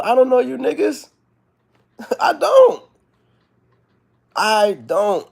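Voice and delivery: high and loud, laughing it off; excited laughing. Real, not acted. Laughing, excited about strangers online.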